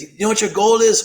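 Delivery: high-pitched Australian challenge; imitated Aussie coach setting a task; egging on with an Australian lilt; Australian accent. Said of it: "You're Australian?".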